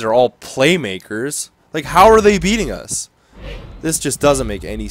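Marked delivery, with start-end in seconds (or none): 1.7-3.1 high, loud incredulous protest; exasperated disbelief. Real, not acted. Gamer cannot believe they are beating him, exasperated. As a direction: raise pitch and volume, baffled and indignant. Baffled at losing to weaker team.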